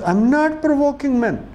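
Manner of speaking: imitating an indignant girl. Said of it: "And she says".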